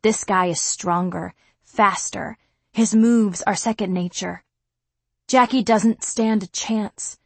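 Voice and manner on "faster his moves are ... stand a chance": ordinary delivery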